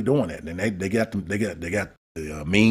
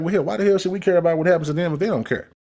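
mock outsider voice; 10 semitones higher than usual. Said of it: "They're like".

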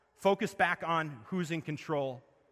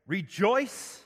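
rejoice called out louder with a rising end; emphatic repeat; 5 semitones higher, 5 dB louder than usual. Acted. Preacher repeating Paul's command to the congregation, called out louder. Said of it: "I will say it again".

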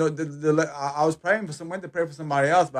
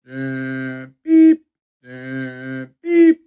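imitating a slow heart monitor, beeps spaced far apart; 3 semitones lower, 7 dB louder, much slower than usual. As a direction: mimic a dying patient's monitor, with long pauses between beeps. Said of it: "it was long gaps, then a heartbeat".

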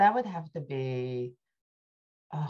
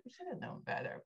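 sheepish admission; 8 semitones higher, 14 dB quieter than usual. Retold or imitated. Retold sheepish admission by social workers.

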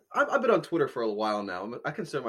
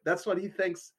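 usual speaking voice

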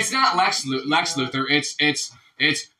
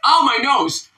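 high, loud mock yelp; acted pained cry; 9 semitones higher, 6 dB louder, slower than usual. Man in a joke walks into a wall, mock pained cry. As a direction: cry out as if just bumped.

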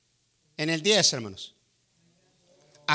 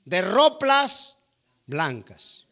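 slow, higher, louder decree; Christ's command; 4 semitones higher, 5 dB louder, much slower than usual. Retold or imitated. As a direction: proclaim it slowly like a royal command. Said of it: "Y dice Cristo".

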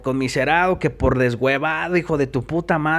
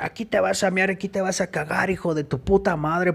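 mock threat; 5 semitones higher, 4 dB quieter than usual. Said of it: "así te lo decían".

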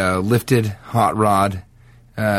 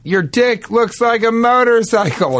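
mock yell; 10 semitones higher than usual. Demonstrating his taunt at bikers on motorcycles, high mock yell. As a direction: call it out slowly in a raised pitch. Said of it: "yell that at someone on a motorcycle".

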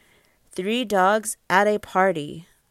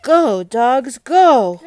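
loud, higher urging call; cheering on; 5 semitones higher, 10 dB louder, slower than usual. Acted. Parent cheers on storybook dogs for child, loud call. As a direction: call out to spur them onward.

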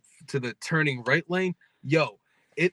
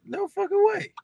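surprised; 4 dB louder than usual. Real, not acted. Shocked reaction to coincidence.

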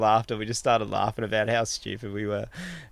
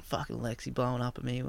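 pissed-off grumble; 10 dB quieter than usual. Retold or imitated. Walking off after an argument, retold pissed-off grumble. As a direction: retell grumbling under your breath. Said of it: "all pissed off".

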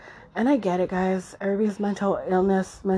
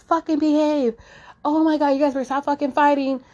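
higher, louder mock yell at squabbling kids; 7 semitones higher, 4 dB louder than usual. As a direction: snap at the kids in the background, half joking. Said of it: "we're yelling at our kids".

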